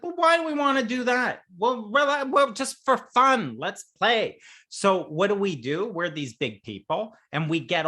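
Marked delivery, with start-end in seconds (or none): 0.0-1.4 high, fast, questioning whine; whiny objector. Retold or imitated. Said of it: "It's like". 1.6-4.4 coaxing. Retold or imitated. Coaxing reply in role.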